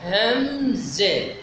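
drawn-out "همزة" in two slow syllables; spelling out. Shown as a demonstration. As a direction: drag each syllable of "همزة" to show the spelling. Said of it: "حال تهجيها بقولنا".

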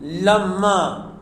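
لما said very slowly, the mim held long and doubled; لما with a geminated, lingering mim; doubled mim in lamma. Shown as a demonstration. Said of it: "التفتوا، بتشديد الميم".

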